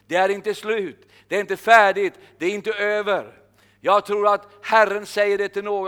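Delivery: loud, fervent sermon delivery; preaching. Acted. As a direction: drive the promise home to the crowd. Preaching to the congregation. (predikan med full kraft)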